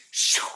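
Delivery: short, breath-driven "shoo" whoosh; whooshing noise. Acted. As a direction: imitate something swept swiftly away. Imitated whoosh as they're carried off.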